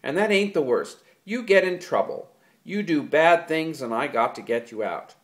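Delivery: loud, high furious accusation. A narrator's reading. George blaming Lenny for lost jobs, furious. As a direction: hammer each complaint home. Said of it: "George went on furiously".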